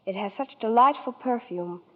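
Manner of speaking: ordinary talk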